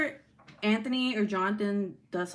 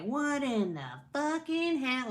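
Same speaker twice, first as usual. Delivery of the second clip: mock yell; 5 semitones higher, slower than usual. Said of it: "yelling at Jonathan and being like".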